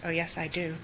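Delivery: ordinary talk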